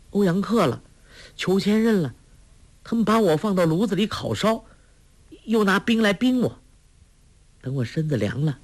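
put-on high, light girl's voice; young girl, smiling. A narrator's reading. Girl recounting her nightmare after waking, high and smiling. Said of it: "笑道".

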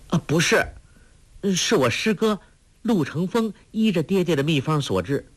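put-on earnest girl's high-pitched reply; young girl answering. A narrator's reading.

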